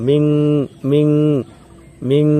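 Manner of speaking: মিং with the ন hummed long through the nose; মিং as a ringing nasal ং, the ন ending in a held hum; nasal ghunna. Shown as a demonstration. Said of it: "উচ্চারণ এরাম শোনা যাবে আওয়াজটা".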